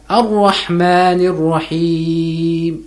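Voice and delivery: Ar-Rahmanir Rahim slowly with both ha from the throat; two throaty ha sounds. Shown as a demonstration. Teacher modelling the verse slowly. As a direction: stretch Ar-Rahmanir Rahim, stressing each ha. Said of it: "The two has".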